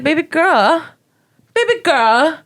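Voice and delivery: high, sugary mock consoling; mock sweet consoling. Comic after stage to the heckler, high sugary consoling. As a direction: coo at her with fake sweetness. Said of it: "I was like".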